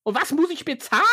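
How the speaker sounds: pushy, wants a price now; impatient broker voice. Acted. Broker continues.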